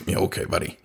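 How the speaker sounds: whispered, scoffing aside; sarcastic whisper. Retold or imitated. Inner thought mocking the van owner, whispered sarcasm. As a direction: scoff it under your breath. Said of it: "And I remember thinking".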